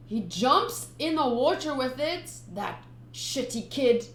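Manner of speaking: plain reading voice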